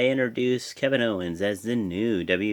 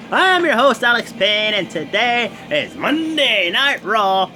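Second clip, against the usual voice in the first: show host intro; 9 semitones higher, 6 dB louder than usual. Acted. Host welcoming YouTube viewers, big announcer opening.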